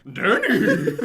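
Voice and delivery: neighing high whinny; horse voice. Acted. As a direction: say it like a horse would. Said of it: "I say it as a horse would say it".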